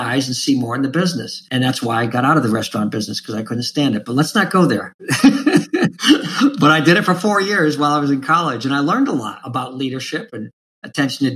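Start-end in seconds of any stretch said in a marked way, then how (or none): 5.0-6.5 high, sudden laugh; burst of laughter. Real, not acted. Guest laughing off his hatred of restaurant work.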